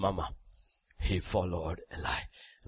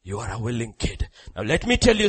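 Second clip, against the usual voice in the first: ordinary delivery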